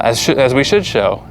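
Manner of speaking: normal talking voice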